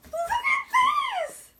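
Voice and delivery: high, loud shriek; shrieking jackpot winner. Real, not acted. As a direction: shriek like you won. Shrieking over shiny suitcase.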